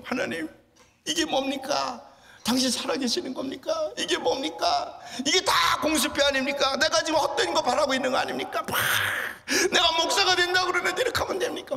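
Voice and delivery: high, loud desperate questioning of God; rolling on the ground, crying out at God; anguished cry. Retold or imitated. Teen boy rolling on the ground, crying out at God. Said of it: "막 뒹굴면서요".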